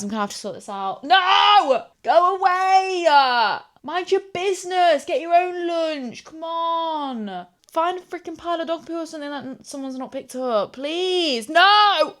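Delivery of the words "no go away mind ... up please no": high and loud, pleading with a fly; exasperated shouting at a fly; frantic scolding. Real, not acted. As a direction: shoo the fly away angrily. Chasing fly off lunch.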